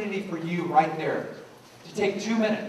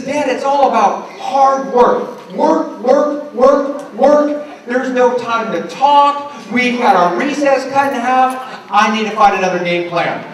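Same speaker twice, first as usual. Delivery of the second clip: slow, higher-pitched mock kid's complaint; imitated complaining son; 5 semitones higher, 10 dB louder, slower than usual. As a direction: act out a kid worn out by hard work. Son's exasperated complaint, acted by dad.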